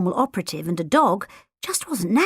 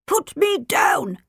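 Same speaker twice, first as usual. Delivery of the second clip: slow, hoarse plea; 7 semitones higher, slower than usual. A narrator's reading. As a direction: croak it out while held up. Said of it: "P C Boot said hoarsely".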